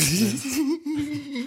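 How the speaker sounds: high-pitched burst of laughter; laughing. Real, not acted. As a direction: break into a giggly laugh. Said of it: "warum lachst du so".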